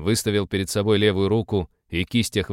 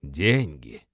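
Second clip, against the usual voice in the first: low drawn-out disgust; disgusted drawl; 6 semitones lower than usual. Acted. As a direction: draw it out in distaste. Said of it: "с отвращением протянул демонолог".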